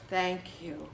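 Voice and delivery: soft thank you. Retold or imitated. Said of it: "And they went".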